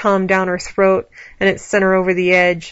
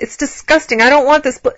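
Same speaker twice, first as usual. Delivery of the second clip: high, loud objection; raised voice, cut off mid-word; protesting; 7 semitones higher, 5 dB louder than usual. A narrator's reading. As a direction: protest urgently, pitched well up. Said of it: "Allie protested".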